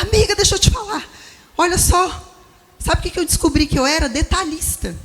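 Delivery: eager confiding to a friend. Retold or imitated. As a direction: rush to tell your friend the discovery. Voicing herself telling a friend. (contando empolgada à amiga, bem mais agudo e rápido)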